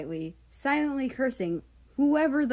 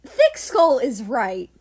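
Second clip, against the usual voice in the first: exasperated groan; 3 semitones higher, 8 dB louder than usual. Acted. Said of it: "Nazi groaned".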